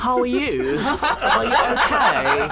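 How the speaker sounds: put-on posh accent. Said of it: "I can put in my posh accent now".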